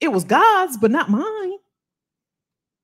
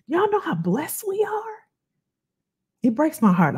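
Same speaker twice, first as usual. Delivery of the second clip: usual speaking voice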